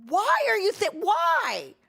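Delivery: very high, loud mock wailing; imitated exasperated wail. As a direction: wail it like a dramatic friend, hammed up. Acts out Zentria's exasperated wail.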